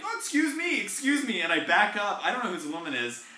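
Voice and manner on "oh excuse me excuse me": much higher, flustered excuse me; timid high apology; startled apology. Retold or imitated. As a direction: back off startled. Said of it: "I'm like".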